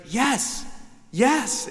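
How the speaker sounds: high, eager mom voice; eager yes. Retold or imitated. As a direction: chirp it like a proud mom. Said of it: "She was like".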